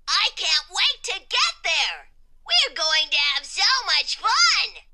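high, loud, eager character voice; bubbling excitement. A narrator's reading. Exclamation Point on the way to school, excited. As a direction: burst with eagerness for the first day. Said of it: "was always excited about something".

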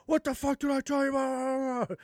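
high, far mock-furious yell; mock angry sergeant. Sergeant flipping out at the private, a mock yell. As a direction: yell like an irate sergeant, hammed up.

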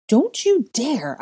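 high, loud, drawn-out warning; sharp warning. A narrator's reading. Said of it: "I warned, stepping back".

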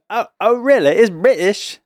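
dodgy British, pitched well up; attempted British accent. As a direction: replay your unconvincing British accent. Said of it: "they said that wasn't a British accent".

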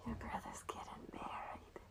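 whispered, barely voiced reaction; hushed whisper. Real, not acted. Reacting to co-host's brother's wedding news, whispered. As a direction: whisper the news back in disbelief.

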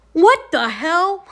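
high, slow, drawn-out mock shock; comic stunned disbelief; retold astonishment. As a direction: act out a baffled friend's reaction. Boyfriend's startled reaction, retold.

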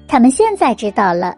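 ordinary delivery

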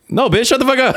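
high-pitched mock fury; indignant retort. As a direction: shoot back at the critics, outraged. Acted retort to online critics.

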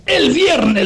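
high-pitched, indignant disbelief; incredulous echo. Retold or imitated. Pepe repeating the caller's date back, incredulous.

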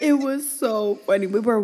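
high, loud, laughing through it; real cracking-up delivery. Real, not acted. Retelling a shared laughing fit.

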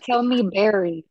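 slow and deliberate, every word distinct; correcting a mishearing, slow and clear; slow, clear repeat. Shown as a demonstration. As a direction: spell it out slowly: Tell me, Barry. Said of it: "I said, I said".

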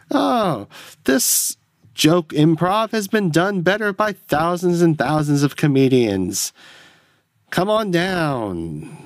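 high, drawn-out salesman voice; bad commercial guy. Salesman mocking his own improv joke, hammed-up commercial voice. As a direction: keep up the cheesy car-ad pitch. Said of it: "I'm your go-to bad commercial guy".

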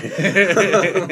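high, loud burst of real laughter; cracking up at a bad line. Real, not acted. Laughing at the script.

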